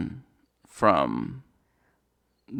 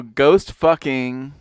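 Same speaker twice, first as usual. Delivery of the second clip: drawn out; 3 semitones higher, 5 dB louder, much slower than usual. Acted. Drawn-out repeat of phrase.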